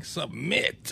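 "Submit" in a light mock Southern twang, rising; a hint of a Southern drawl on "Submit". Co-host mocking the officiant's "Submit" with a light Southern twang. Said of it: "He said it with a twang".

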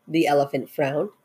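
ordinary delivery, nothing marked

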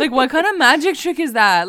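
high, loud, laughing incredulity; loud amused protest; laughing disbelief. Real, not acted. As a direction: say it through a real laugh, baffled. Retelling own disbelief, laughing along.

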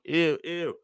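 high, prissy ew of disgust; mock disgust. Coach imitating women rejecting him, high mock disgust. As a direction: squeal it like a repulsed woman.

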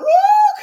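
high whooping cry of praise; ecstatic woo. Real, not acted. Preacher whooping to the congregation, ecstatic high woo. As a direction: let out a joyful woo.

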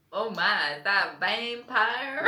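slow, drawled southern belle voice; a playful, lightly put-on southern accent; mock southern belle. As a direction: play it as a swooning southern lady. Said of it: "The only way I can be southern".

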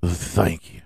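breathy whispered thank you. Acted. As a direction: whisper it close to the mic.

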